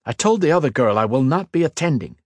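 sharp, irritated snap; snapping. Acted. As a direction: bite the words off in annoyance. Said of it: "he snapped".

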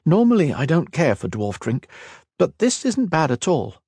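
high, easy conversational lilt; lightly voiced elf. Kira sampling dwarf whiskey, lightly voiced elf.

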